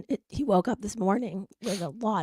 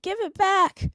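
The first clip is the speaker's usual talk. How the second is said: put-on high, louder child demand; bossy toddler; 9 semitones higher, 6 dB louder, slower than usual. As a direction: order it like an upset little boy. Son demanding cloth back.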